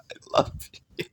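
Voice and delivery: breathy whisper with no voice, "you" leaned on; hushed secret whisper of "I love you". Shown as a demonstration. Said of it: "saying it or hearing you say it".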